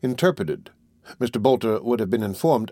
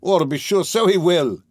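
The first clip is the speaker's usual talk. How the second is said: high, raised, delighted voice; eager agreement; 9 semitones higher, 4 dB louder than usual. Acted. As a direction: agree heartily, lifting the voice.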